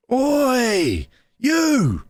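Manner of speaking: high-pitched, drawn-out call; retold shout across table. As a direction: imitate someone hollering across a table. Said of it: "he's shouting across the table".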